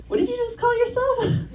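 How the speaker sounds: high, disbelieving mock question; incredulous Saulteaux woman. Saulteaux woman questioning how Monique names her tribe, incredulous high pitch. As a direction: act out her shock at the wrong name. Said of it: "she was like".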